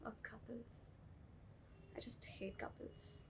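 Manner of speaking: very soft, slow muttered aside; muttered disgust. Acted. Aside of disgust about couples.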